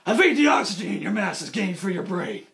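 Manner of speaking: low, gruff Batman; mock Batman voice; Batman growl. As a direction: growl like the hero. Batman retort.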